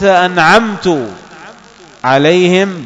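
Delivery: slow أنعمت ending in tu, anʕamtu; wrong u ending. Shown as a demonstration. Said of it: "بضم التاء".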